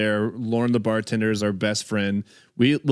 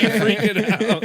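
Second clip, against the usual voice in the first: ordinary delivery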